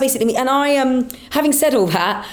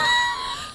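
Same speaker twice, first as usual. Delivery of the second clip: high shriek of laughter; 7 dB quieter than usual. Real, not acted.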